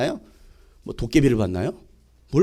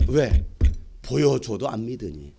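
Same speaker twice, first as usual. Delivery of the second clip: ordinary talk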